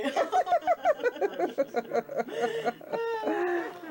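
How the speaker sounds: high laugh close to crying; tearful laugh. Real, not acted. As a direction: laugh till near tears. Laughing after cousin talk.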